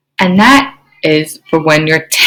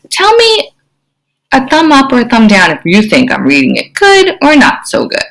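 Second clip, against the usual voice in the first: usual speaking voice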